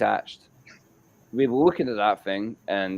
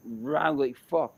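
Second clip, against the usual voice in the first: plain reading voice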